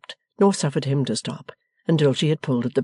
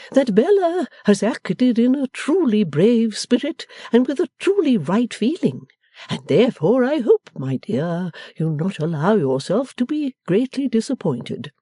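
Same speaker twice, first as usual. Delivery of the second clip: high, swooping, gentle husband's voice; submissive; 9 semitones higher than usual. A narrator's reading. R W defending Bella to his wife, submissively. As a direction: plead meekly on a daughter's behalf.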